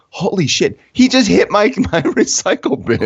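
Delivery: high-pitched, amused disbelief; retold shock. Teller reliving the carrier hitting his bin, mock shocked. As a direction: replay your astonishment, half laughing.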